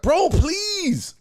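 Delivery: high, whiny mock plea; pleading "bro please". Mimics the scared man pleading with his attacker, mock whine. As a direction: beg like a scared guy backing off. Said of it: "listening to him start".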